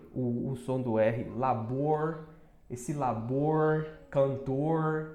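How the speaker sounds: labor and cantor with a curled final R, slowed; slow retroflex R; curled R demo. Shown as a demonstration. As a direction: stretch the R at the end of labor. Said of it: "Vai falar mais assim".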